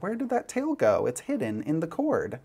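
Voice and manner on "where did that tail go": playful higher where-did-it-go; mock surprise. To viewers, showing the hidden tail in playful mock surprise. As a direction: pretend to be amazed it vanished.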